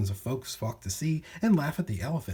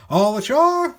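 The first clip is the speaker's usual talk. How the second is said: much higher, loud dock call; loud shout; 11 semitones higher, 11 dB louder, slower than usual. Acted. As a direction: bellow it to everyone on deck. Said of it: "the men shouted".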